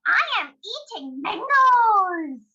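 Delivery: high, loud, drawn-out monkey. Acted. Storyteller as monkey telling the crocodile, excited monkey voice. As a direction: crow it out slowly in a high monkey voice. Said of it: "And then monkey says".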